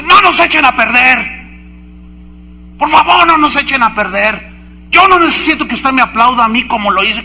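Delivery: mock indignant plea; imitated angry scolding. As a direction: play an annoyed speaker chiding the audience. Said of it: "Pero estaba enojado".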